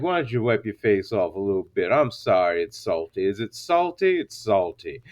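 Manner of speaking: very low, soft mock concern; deep, hushed retold bedroom voice. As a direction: imitate him murmuring an apology deep down. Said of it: "and he's like".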